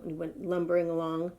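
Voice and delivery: usual speaking voice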